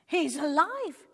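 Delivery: loud, slow joyful exclamation; excited exclaiming. A narrator's reading. Villagers seeing the sheep move, exclaiming with joy. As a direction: burst out with glad surprise. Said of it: "they exclaimed".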